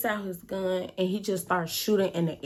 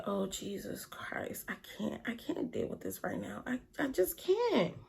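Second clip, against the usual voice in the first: hushed, understated panic, much softer; retold panic; 11 dB quieter than usual. Friend missing after the shootout, hushed retold panic. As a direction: imitate being overwhelmed, almost under your breath. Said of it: "I'm panicking at this point now".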